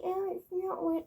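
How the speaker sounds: high mocking whine of a defensive furry. Mocking a furry commenter in a high whine. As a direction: parody a furry insisting it's fine.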